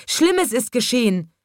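high, alarmed cry from the animals; aufgeregter Schrei. A narrator's reading. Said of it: "schrien die Tiere". (aufgeregt gerufen, mit hoher Stimme)

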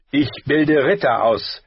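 stern, forceful retort; sharp. Acted. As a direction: snap back at the insult. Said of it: "sagte Sir Roderick scharf".

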